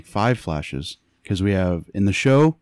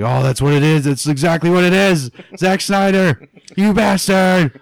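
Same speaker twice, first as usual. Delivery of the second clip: hammed-up indignant shouting; mock outraged yelling; 9 semitones higher, 8 dB louder than usual. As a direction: yell it like a fan who's just been had. Mimics outraged fans yelling at director.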